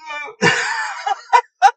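high, loud, near-crying laughter; real laughing fit. Real, not acted. Host cracking up at friend's toilet plight, helpless laughter.

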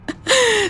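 short, high-pitched burst of laughter. Real, not acted. Streamer reacting to an ankle attack, high laugh.

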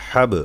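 হাব with the final b bounced; qalqalah. Shown as a demonstration. Teacher pointing out the qalqalah letter, হাব with bounced b. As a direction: push the b of হাব out with a small echo. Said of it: "এই যে বা কলকলা".

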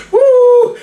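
high, loud whoop; real high-pitched whoop amid laughter; gleeful whoop. Real, not acted. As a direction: let out a piercing woo.

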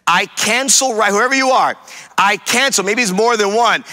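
high, forceful preaching prayer; declarative deliverance prayer. Real, not acted. Preacher binds torment over viewers at home, praying forcefully. Prays against the devil's plan.

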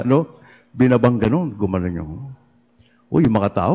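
ordinary delivery

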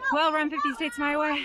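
panting after the climb; out of breath. Real, not acted. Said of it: "I'm still out of breath".

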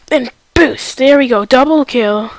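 loud, excited victory call; excited shout. Real, not acted. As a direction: shout the win with glee. Gamer triumphs with double kill.

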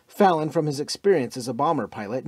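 ordinary delivery

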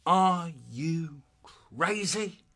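very slow, drawn-out disbelief; incredulous dad. Acted. Dad reacting to son's excuse, incredulous. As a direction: stretch every word in amazement. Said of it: "he goes".

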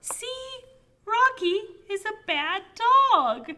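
high, emphatic storybook complaint; reading to children. Acted. Child narrator proving the pet is bad, emphatic storybook reading. Reads picture book aloud.

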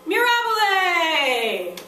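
slow, loud, high "Mirabile!" drawn out; mirabile exclamation. Shown as a demonstration. Teacher showing the class the mirabile cheer. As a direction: stretch "Mirabile!" out like a marvel. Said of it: "This is for two things".

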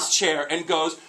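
normal talking voice

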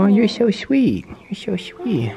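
high, slow pet talk; cooing to animal. Real, not acted. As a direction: coo sweetly to a horse. Cooing to a horse.

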